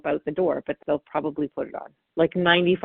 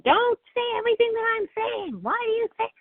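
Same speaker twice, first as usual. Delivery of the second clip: put-on a much higher, peeved kid's voice; whiny child. Child objecting to parent's canned lines, whiny and indignant. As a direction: whine back like a child who sees through it.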